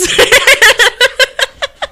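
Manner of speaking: high, helpless laughing fit; real laughter. Real, not acted. Host cracking up over a Ted line, laughing high. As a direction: crack up at your own quote.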